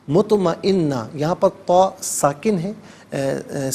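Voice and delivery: ordinary talk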